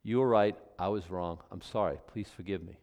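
soft, low, fading to a hush; humble and simple, nothing added; unqualified apology. Shown as a demonstration. Preacher modelling the unqualified apology, softly humble.

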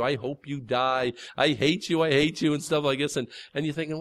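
usual speaking voice

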